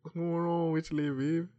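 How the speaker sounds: high, loud voice with rising endings. Acted. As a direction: call out in a put-on rapper voice. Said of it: "start talking like [name]".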